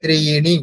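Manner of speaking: ত্রিণি with the final i held long; ত্রিণি with lengthened ending. Shown as a demonstration. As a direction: stretch the ণি in ত্রিণি. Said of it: "একটু বেশি সময় দিতে হবে".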